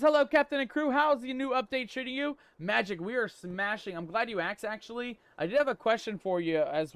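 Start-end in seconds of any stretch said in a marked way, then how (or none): 0.0-2.4 chat message read out. Retold or imitated. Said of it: "Magical Pirate says".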